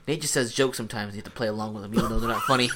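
mock sulky complaint. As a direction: imitate a friend griping, hammed-up and hushed. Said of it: "how you said it in that tone".

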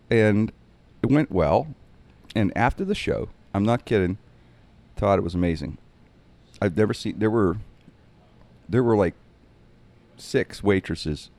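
normal talking voice

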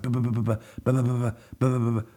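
rapid ba-ba-ba gunshot noises; mock gunfire. Reviewer imitating Deadshot's gunfire on the car, mock gunshot sounds. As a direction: rattle it off like a machine gun.

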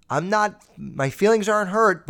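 ordinary talk, nothing marked

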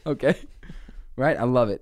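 ordinary talk

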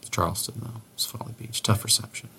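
hushed, breathless aside; whispering. Real, not acted. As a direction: drop to a near whisper. Waiting on bad phone line.

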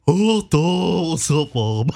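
lightly put-on goofy dog voice; mildly dopey pet voice; dog-talk voice. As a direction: talk for the dog, a little silly. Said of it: "I was always with my dog".

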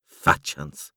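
plain reading voice

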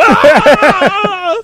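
loud, high mock-stroke wail; mock stroke cry. Man clowning that he's having a stroke, loud fake wail. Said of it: "No, that's a stroke, dummy".

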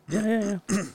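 high, quick yeahs; mock brushing off. Widow on stage brushing off the singer, high. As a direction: wave him off lightly. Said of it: "And she goes".

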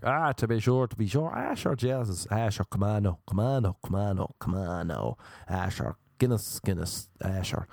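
hammed-up Irish patter, phrases tumbling round; stage Irish charmer. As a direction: lay on mock Irish charm. Hams up Irishness to charm women.

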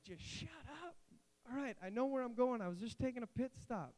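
comic high-voiced protest; mock exasperation. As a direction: act fed up with a nagging GPS. Snapping back at an annoying GPS.

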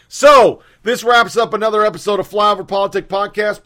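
booming show sign-off; loud announcement. Real, not acted. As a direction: announce it loudly. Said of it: "outro announcer shout".

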